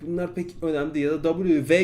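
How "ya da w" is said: ordinary delivery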